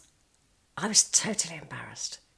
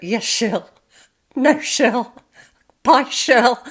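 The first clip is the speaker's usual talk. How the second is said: louder, higher hammed-up "Yes, Shirl" with falling ends; mock henpecked husband; 6 semitones higher, 10 dB louder, much slower than usual. Boss placating wife Shirl, hammed-up and louder. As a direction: ham up the yes-dear replies for laughs. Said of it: "he just went".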